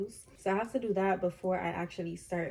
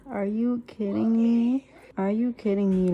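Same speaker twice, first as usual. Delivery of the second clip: hyped; 7 dB louder, much slower than usual. Real, not acted. Hyped about the performance.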